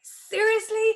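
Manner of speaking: high, loud incredulous question; stunned disbelief. Retold or imitated. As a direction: fire it up high, amazed. Reacting to the film offer.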